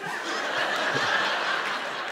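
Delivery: soft unvoiced chuckle; airy smug snicker; breathy mocking laugh. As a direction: laugh quietly at him. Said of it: "And he's like".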